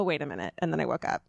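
usual speaking voice

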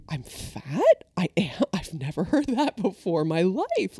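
incredulous question, rising on the key word; retold disbelief. Child stung by the coach's remark, in retold disbelief. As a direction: replay a kid's baffled reaction.

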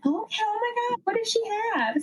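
high, squealy excitement over a gift; breathless high thrill; giddy anticipation. Retold or imitated. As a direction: gush like a kid about to open a present. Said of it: "And I'm like".

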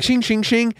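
high ringing sword sounds; sword clang noises. Retold or imitated. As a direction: mimic clashing blades. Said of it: "it'd be like".

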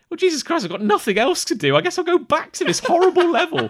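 high, loud, fed-up sigh of a line; exasperated resignation. Retold or imitated. Husband alone at night with sleeping wife and cat, high and exasperated. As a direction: groan it out like there's nothing left to do. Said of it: "And I go".

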